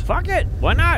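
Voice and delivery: high shrill why not; squeaky mock shrug. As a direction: throw up your hands in a shrill squeak. Mock exasperated agreement.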